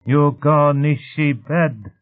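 high, very slow insistent command; emphatic creature order. A narrator's reading. Whitey repeats the order to Ryle, emphatic creature command. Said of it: "Whitey repeated, and emphasized the order".

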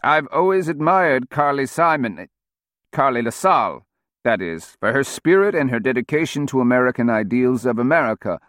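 lightly voiced, halting old politician. A narrator's reading. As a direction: read fed lines like a dazed old man. Old speaker reading fed lines.

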